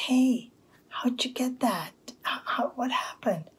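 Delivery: high, insistent questioning; acted eager neighbor, voice up high; nosy neighbor. Acted neighbor asking at the door.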